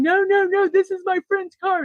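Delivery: high-pitched mock protest; whiny-high retold objection; arguing driver. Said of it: "the other guy's arguing".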